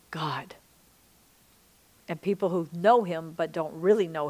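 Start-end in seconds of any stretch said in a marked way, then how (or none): 0.1-0.5 whispered God. Real, not acted. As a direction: drop to a soft low whisper on "God". Gentle close after making a point.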